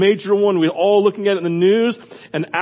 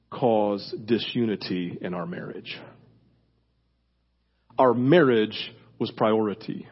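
earnest preaching; 11 semitones lower, 6 dB quieter than usual. Acted. Earnest low close of sermon.

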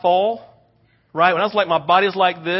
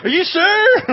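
high, loud anxious question; nervous check; 6 dB louder than usual. Retold or imitated. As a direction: ask one more time, high and loud. Checking the kids before falling.

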